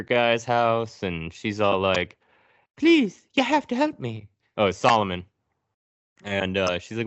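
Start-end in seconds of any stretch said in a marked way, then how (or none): 2.8-4.3 put-on much higher pleading girl voice; hammed-up falsetto plea; mock plea. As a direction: beg for help like a scared girl. Sarah pleads, voiced high.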